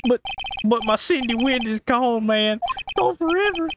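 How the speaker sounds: lightly drawled country voice; a hint of a Southern twang; country accent. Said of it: "why are you country now".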